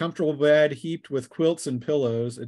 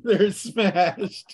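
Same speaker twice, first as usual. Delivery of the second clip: laughing it out, higher and louder; 5 semitones higher, 5 dB louder than usual. Real, not acted. GM laughing at the drunk gnome and dwarf, laughing. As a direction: crack up while saying it.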